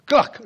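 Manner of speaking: high, loud snap of a sound; sharp clack. Retold or imitated. Storyteller mimics the husband's eyes snapping open, loud and high.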